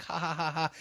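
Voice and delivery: high, oblivious fake laugh; mock movie laughter. Imitating a friend laughing inside VR goggles, mock laugh. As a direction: play someone chuckling away at a movie. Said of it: "you'll be, like".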